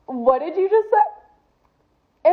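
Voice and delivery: indignant disbelief. Acted. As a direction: stretch it out in shocked offense. Reacting indignantly to smug guy.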